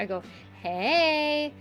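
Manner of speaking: ordinary delivery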